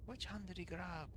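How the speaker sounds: Finan impression. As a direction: imitate Finan's Irish accent from the clip. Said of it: "I bet I can do that".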